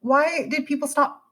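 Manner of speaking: high, baffled asking; mock dismay. Creator puzzling over silent followers, high baffled whine. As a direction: plead to know where they went. Wondering why comments stopped.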